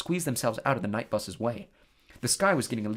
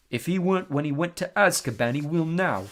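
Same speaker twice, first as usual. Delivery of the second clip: slow voice; 4 semitones higher, 4 dB louder than usual. Acted. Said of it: "said Anne in his slow voice".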